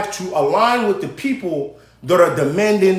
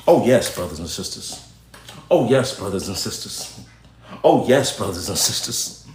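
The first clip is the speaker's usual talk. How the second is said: much lower, mock preacher intoning; 11 semitones lower than usual. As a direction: lower the voice like a sermon. Mocking a preacher's address.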